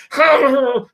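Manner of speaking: high strained cry; imitated frustrated yell. Retelling a scientist's failed arm test, a high cry. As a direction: mimic his frustrated outburst. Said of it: "he's making some really funny noises".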